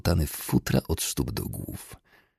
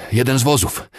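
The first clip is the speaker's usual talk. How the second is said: high, loud, winded report; panting; 8 semitones higher, 5 dB louder than usual. A narrator's reading. Rider reports a find to the colonel, panting. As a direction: get the news out while puffing. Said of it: "wysapał przybysz".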